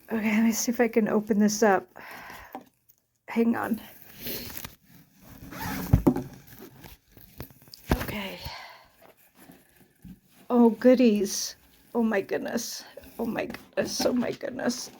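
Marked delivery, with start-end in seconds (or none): 3.3-8.4 breathy whisper under the breath. Real, not acted. Quietly opening the package. 11.9-14.9 soft, breathless whisper; whispered in wonder; whispered awe. Real, not acted. Overwhelmed while unboxing.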